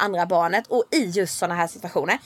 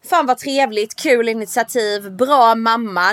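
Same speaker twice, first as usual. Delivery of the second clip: smug slow praise; slower than usual. Retold or imitated. Self-congratulating inner voice.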